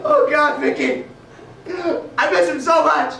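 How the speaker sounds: loud, high-pitched wail of loss; hammed-up grief. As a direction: cry out as if heartbroken, played for laughs. Father wailing over absent son-in-law.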